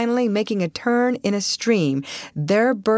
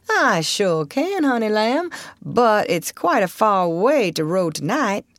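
put-on old Southern boatman, obliging; 4 semitones higher than usual. Old boatman agreeing but warning of the distance, drawling.

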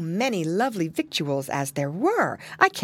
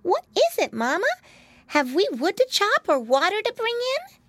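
put-on very high child piglet voice, questioning; piggy boy. A narrator's reading. Piglet asking mama about chores, high questioning voice. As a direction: ask mama eagerly in a high little-boy squeak.

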